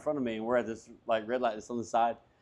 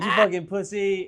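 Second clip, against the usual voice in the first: loud, high, drawn-out jeer; slow mock shout of an insult; retold taunt; 6 semitones higher, 5 dB louder than usual. Driver jeering the man who backed down, retold taunt.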